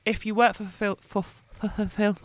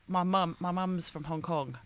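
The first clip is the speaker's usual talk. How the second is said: ordinary delivery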